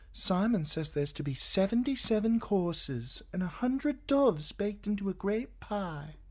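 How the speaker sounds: high, eager, gushing rush. A narrator's reading. Shay gushing to Tyrion about the feast, high and excited. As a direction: spill it out in delight. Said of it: "Shay gushed".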